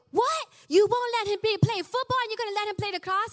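high, loud, fast outraged protest; indignant teen. Retold or imitated. Brother protesting to mom about unfair rules, high outraged squeal. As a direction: squeal it like a wronged little brother.

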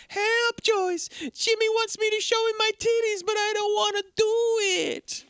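very high, hammed-up damsel voice; imitating a woman pleading in falsetto; mock woman's plea. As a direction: cry for help in a silly falsetto. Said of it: "he starts doing an impression of her".

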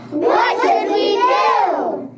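high, slow call; crying out. A narrator's reading. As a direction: call out for help. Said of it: "The children cried out".

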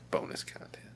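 whispered aside; breathy whisper of bonus content. Acted.

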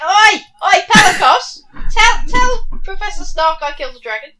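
loud shout across the room; calling out. Acted. Halfling shouting to Pelicos across the dig. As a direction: yell to get Pelicos's attention.

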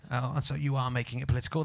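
ordinary delivery

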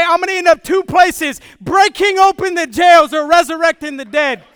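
loud sing-song preaching cry; fiery preacher's chant; triumphant shout. Acted. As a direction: throw it back in triumph. Defiant answer, shouted preaching.

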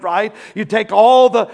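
ordinary delivery

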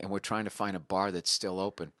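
usual speaking voice

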